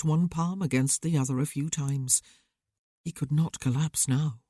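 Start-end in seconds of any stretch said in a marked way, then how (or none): none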